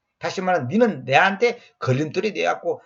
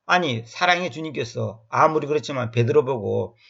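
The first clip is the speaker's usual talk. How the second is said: ordinary talk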